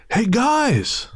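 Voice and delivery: high, sing-song call from out of nowhere; stranger calling out. Retold or imitated. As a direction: call out to them like an overfamiliar stranger. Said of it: "we hear a male voice go".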